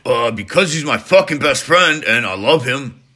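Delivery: loud, defensive retort; indignant outburst. Acted. Character defends himself against host's challenge.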